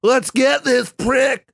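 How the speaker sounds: high, slow hammed-up mob cry; rally the mob, hammy and drawn out; mock rioter battle cry. Voicing the mob.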